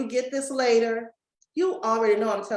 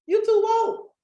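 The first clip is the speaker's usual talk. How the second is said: much higher and louder, punched out; emphatic shout; 10 semitones higher, 5 dB louder than usual. Shown as a demonstration. To somebody special in the chat, a shouted tease. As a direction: belt it out for the one who knows. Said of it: "I did that for somebody special".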